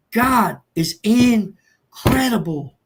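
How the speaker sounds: every word of "God is incredible" drawn out slowly; awed emphasis. Real, not acted. Man testifying to the group about God, "God is incredible" slow and emphatic.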